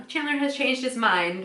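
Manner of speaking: normal talking voice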